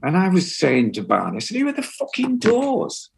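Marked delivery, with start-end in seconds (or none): 1.6-3.1 much higher, laughing disbelief; incredulous. Retold or imitated. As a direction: scoff in baffled disbelief. Incredulous rant about The Doors.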